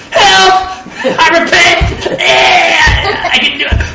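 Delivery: hammed-up panic, shouting from under the weights; panicked cry for help. Man pinned under weights shouting to Jesus, hammed-up panic.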